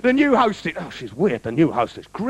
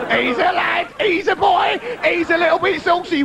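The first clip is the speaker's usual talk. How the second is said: mock cheering; 5 semitones higher than usual. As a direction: hype the lad up like a cheering mate. Cheering the cheeky lad.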